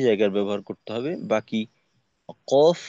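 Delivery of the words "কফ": কফ from low in the throat, louder; deep qaf. Shown as a demonstration. Teacher stressing where the letter is made, deep and loud. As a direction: push কফ from deep down. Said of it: "তার থেকেও একটু নিচে".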